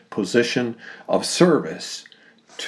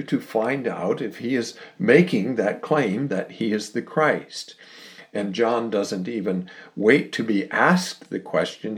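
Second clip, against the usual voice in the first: usual speaking voice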